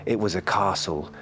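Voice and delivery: ordinary delivery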